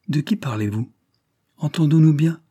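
mildly troubled questioning, lifting at the end; slightly flustered. A narrator's reading. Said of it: "répliqua madame d'Albreuse un peu troublée".

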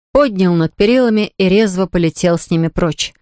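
plain reading voice

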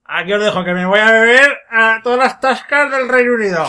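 high, loud mock boozer's farewell; a tipsy father announcing he's off to the pubs; drunk father leaving. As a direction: ham up the drunk dad walking out. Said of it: "su padre dijo".

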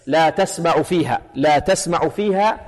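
تسمع with fatha on the taa, "tasma'u"; measured recitation, تسمع as "tasma'u"; Hafs reading. Shown as a demonstration. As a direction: slowly recite تسمع opening on "ta". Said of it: "لأن حفص يقرأها ماذا".

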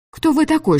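high-pitched outraged heroine; angry challenge. A narrator's reading. Girl defying the director's orders, angry challenge. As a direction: challenge his authority, pitch leaping up. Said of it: "хотелось орать и драться".

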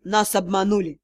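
low, hoarse, defeated words. Acted. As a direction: rasp it out, crushed. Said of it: "хрипло произнёс".

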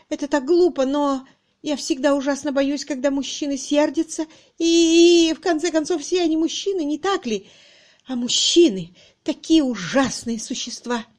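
high, distraught, faltering confession; tearful. A narrator's reading. Miss Twitterton confessing fear of men, tearful. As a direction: whimper the fear out, close to tears. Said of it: "Конец фразы утонул в новом потоке слёз".

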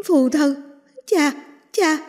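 high, loud wailing cry; burning scream. Acted. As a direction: wail over the father's body. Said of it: "gào khóc đau đớn".

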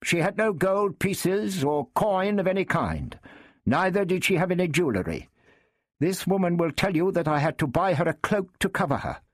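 high, brisk knight. A narrator's reading. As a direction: argue quickly as the knight. Knight voiced, higher, fast.

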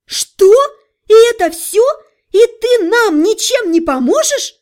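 loud, high outcry; shocked. A narrator's reading. Heroine shocked.

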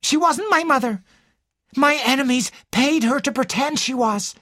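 high, indignant protest; angry. A narrator's reading. Ginevra denies the woman was her mother, angrily. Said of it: "stamped her foot angrily".

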